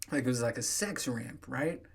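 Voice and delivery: ordinary talk, nothing marked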